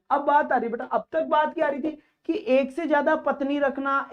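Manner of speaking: usual speaking voice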